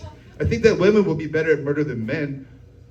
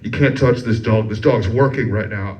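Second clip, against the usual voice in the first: a deeper mock stern veteran voice; lower gruff voice; 9 semitones lower, 3 dB louder than usual. Veteran telling the comic off, in a low stern voice. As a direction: drop the pitch low and firm, playing the vet. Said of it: "He goes".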